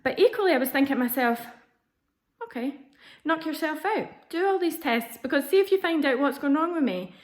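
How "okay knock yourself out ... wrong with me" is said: raised-pitch, daring retort; defiant go-ahead. Retold or imitated. Patient silently daring doctor to test, mock defiant. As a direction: toss it out high, egging them on.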